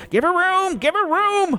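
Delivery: high-pitched shout to clear a crowd; narrated shout. A narrator's reading. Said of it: "Halio shouted".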